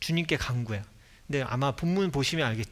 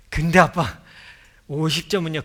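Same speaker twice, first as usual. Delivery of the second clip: put-on a kid's much louder insistent question; child pushing dad; 8 dB louder than usual. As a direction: press your dad like a stubborn kid.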